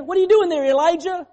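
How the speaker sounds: high, loud and accusing; the wrong, harsh version of God's question; mock angry shout. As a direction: bark it at him like a scolding God. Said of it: "He didn't say that".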